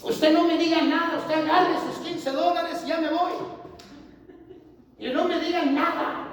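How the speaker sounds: high, loud firm insistence; insisting. Retold or imitated. Preacher telling the lady not to say anything, loud insistence. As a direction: refuse any thanks, forcefully.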